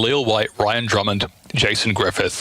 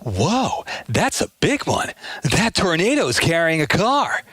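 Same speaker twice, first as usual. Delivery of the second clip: sing-song up and down, raised; Jason Griffith Sonic; 7 semitones higher than usual. Pretend. Hero gawking at a tornado, pitch swooping. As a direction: swing pitch up and down in awe. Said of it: "his voice sort of goes up and down like this".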